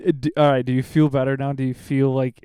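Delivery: ordinary talk, nothing marked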